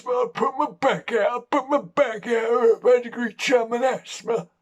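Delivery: put-on high-pitched, wheezy old Cockney dog; breathless Cockney dog. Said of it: "he's breathless and cockney".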